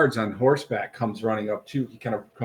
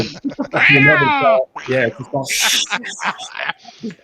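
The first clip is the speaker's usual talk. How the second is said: high squealing laugh through the words; 10 semitones higher, 7 dB louder, much slower than usual. Real, not acted. Game master cracks up at his own slip, laughing.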